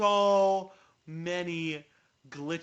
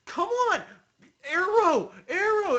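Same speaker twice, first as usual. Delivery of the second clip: frustrated shout; 13 semitones higher, 9 dB louder than usual. Retold or imitated. Retold frustration at stuck bow.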